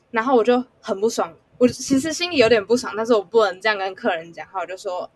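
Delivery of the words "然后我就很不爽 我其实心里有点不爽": ordinary talk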